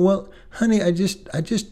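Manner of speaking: lightly voiced wounded wife; upset wife.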